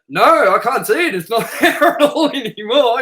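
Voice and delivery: high, loud, laughing through the lie; cracking up while pretending it's gone; laughing fake denial. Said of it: "I was like".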